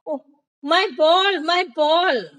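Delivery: high, loud cry of dismay; alarmed shout. A narrator's reading. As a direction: shout out in alarm over a loss. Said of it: "suddenly she shouted".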